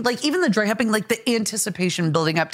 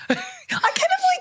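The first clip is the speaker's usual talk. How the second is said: squeaky embarrassed; slower than usual. Real, not acted. Laughing at awkward question.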